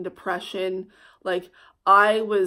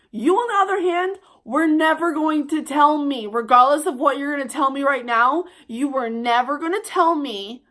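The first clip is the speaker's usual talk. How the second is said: put-on higher, louder, scolding the boyfriend; angry telling off; 5 semitones higher, 4 dB louder than usual. Retelling how she told off her boyfriend, in an angry higher voice. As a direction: let him have it.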